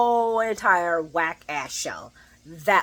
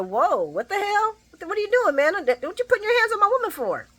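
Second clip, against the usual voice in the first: outraged Dex; 12 semitones higher than usual. Retold or imitated. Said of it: "Dexter go".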